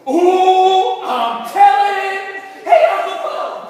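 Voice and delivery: loud, high singsong tease; taunting. Acted. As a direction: sing it out to tattle. Kids taunting, calling out.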